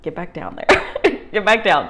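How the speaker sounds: a touch of stern dog-owner scolding; mild mock scolding of a pet. Scolding her emotion like a dog.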